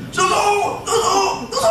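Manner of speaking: high repeated shouts with a laugh; mock shouting. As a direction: shout it without thinking. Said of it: "focus on shouting as loudly as possible".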